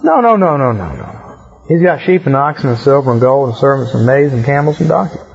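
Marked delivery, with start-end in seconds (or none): 0.0-1.1 emphatic no. Real, not acted. Teacher waves off the pious pitch.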